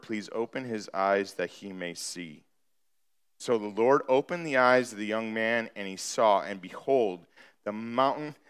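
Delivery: low, softened suspenseful scripture reading; grave, subdued Bible narration; hushed storytelling. Acted. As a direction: draw them in with a low, quiet voice.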